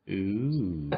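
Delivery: ordinary talk, nothing marked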